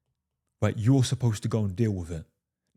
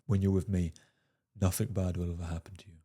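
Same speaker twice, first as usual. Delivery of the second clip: low, soft, soothing and unhurried; calm reassurance; 5 semitones lower, 6 dB quieter than usual. Shown as a demonstration. Showing the men his tone, low soft reassurance. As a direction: reassure her like nothing can go wrong. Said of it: "or a very similar tone I use".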